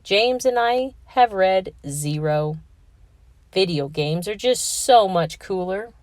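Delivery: usual speaking voice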